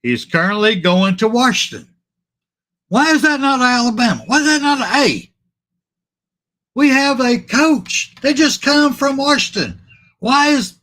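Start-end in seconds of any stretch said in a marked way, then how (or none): 2.9-10.8 high, fired-up questions, then cut short; worked-up rant. Real, not acted. Host catching himself getting heated about a recruit, pitch way up. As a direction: get carried away, voice climbing. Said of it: "sorry, sorry".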